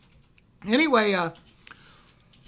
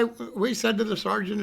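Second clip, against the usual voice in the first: ordinary talk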